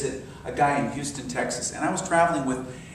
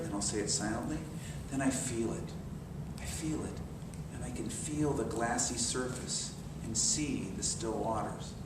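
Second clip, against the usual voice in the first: much softer, near a whisper; softer, fading hush; 2 semitones lower, 11 dB quieter than usual. Shown as a demonstration. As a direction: let it sink to a hush. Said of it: "Maybe I'll whisper it".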